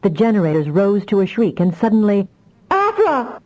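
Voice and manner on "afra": put-on much higher, panicked child's cry; a frightened little girl crying out; terrified cry. A narrator's reading. Lost child crying out terrified to Afra. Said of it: "a terrified voice cried from the void".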